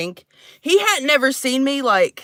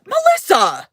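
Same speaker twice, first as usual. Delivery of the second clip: higher, louder call of the name; 8 semitones higher, 4 dB louder, slower than usual. Acted. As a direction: yell the name to call her out. Calling out a viewer.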